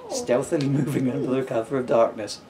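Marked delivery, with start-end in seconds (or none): none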